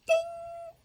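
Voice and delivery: very high, ringing ding; loud, pitched twang sound. A narrator's reading. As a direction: imitate a snapping string with a sharp ding. Imitates rope snapping.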